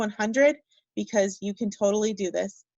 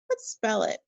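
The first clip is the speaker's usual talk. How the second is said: ordinary talk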